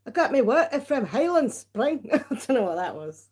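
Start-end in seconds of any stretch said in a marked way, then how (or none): 0.1-2.1 higher-pitched, swooping mock Scottish; playful amateur Scottish accent; attempted Scottish accent. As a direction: have a shaky go at a Scottish accent. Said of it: "That was an attempt at a Scottish accent".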